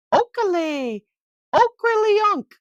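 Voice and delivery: high, chirpy birdcall; blackbird song. Acted. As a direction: trill it like a red-winged blackbird. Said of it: "the red-winged blackbirds sing".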